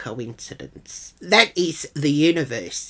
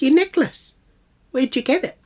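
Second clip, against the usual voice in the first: high, insistent demand; put-on sharp, high questioning; imitated accusation; 9 semitones higher, 4 dB louder than usual. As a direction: mimic her pressing for an answer. Imitating Jill pressing her accusation.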